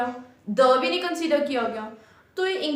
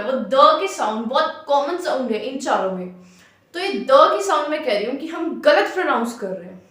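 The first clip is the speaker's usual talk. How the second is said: usual speaking voice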